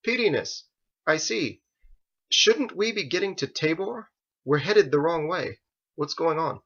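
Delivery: put-on a nervous young man, puzzled and uneasy; young Joseph voice.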